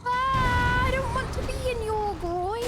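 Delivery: high drawn-out cry of protest; alarmed wail. Real, not acted. Player stuck under a huge enemy, high protesting wail. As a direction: wail it high, starting loud.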